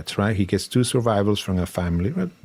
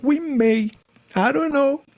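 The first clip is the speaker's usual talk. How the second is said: high, teasing sing-out of doubt; coy hedging prosecutor; 13 semitones higher, slower than usual. Retold or imitated. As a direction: hedge it playfully in a much higher voice. Prosecutor hedging in retold exchange.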